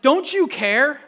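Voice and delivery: loud, high accusing plea; yelling. Retold or imitated. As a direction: yell it as a desperate question. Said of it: "they're yelling at him like".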